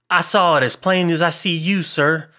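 high-pitched, earnest insistence; solemn. A narrator's reading. Waiter swearing to the gentleman he saw it, solemn. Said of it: "said George solemnly".

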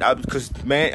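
ordinary delivery

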